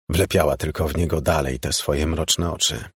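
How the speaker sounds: usual speaking voice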